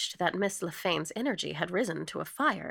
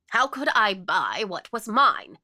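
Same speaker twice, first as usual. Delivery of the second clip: loud, slow, affronted question; affronted and emphatic; indignant protest; 3 semitones higher, 9 dB louder, slower than usual. Acted. Indignant claim of ownership.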